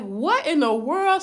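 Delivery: stunned, not believing it. Retold or imitated. Woman hanging up the phone, baffled and slow.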